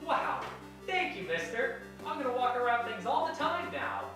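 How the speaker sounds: high, bright eager-pupil cheer; eager excitement. Acted. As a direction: squeal it like an excited kid. Grateful pupil in instruction skit.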